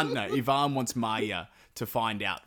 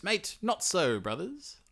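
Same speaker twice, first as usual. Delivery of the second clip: mild mock snap; 9 semitones higher, slower than usual. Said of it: "snaps and he goes".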